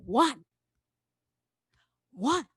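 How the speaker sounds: "One" said full and weighty, standing alone; emphatic, committed "One"; gospel one. Shown as a demonstration. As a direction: say "One" like the most important word ever. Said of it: "I want you to say a gospel one".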